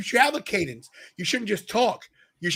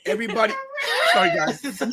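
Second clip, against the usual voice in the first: laughing; 6 semitones higher than usual. Real, not acted. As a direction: burst into laughter mid-apology.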